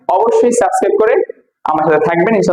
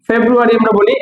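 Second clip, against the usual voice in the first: ordinary talk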